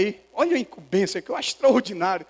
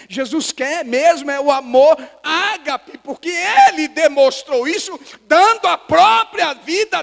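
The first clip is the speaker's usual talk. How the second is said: loud, raised preacher's voice; impassioned preaching; 7 semitones higher, 9 dB louder than usual. Real, not acted. Pastor voices Jesus's proof of love for Peter, impassioned preaching. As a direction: drive each phrase home at full force.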